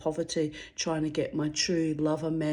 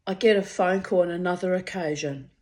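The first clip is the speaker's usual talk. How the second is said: normal talking voice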